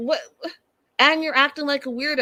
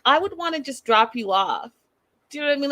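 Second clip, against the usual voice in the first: usual speaking voice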